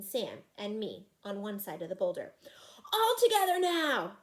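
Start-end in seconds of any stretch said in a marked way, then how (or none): 2.9-4.2 high loud rallying shout; rally everyone with a shout; yelling. Acted. Fred rallies everyone to push, high loud shout. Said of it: "yelled Fred".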